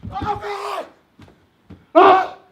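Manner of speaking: very high, frantic panicked yelling that fades at the end; Chris Farley impression. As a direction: scream like you're being abandoned, then trail off. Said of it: "This is an impression of Chris Farley".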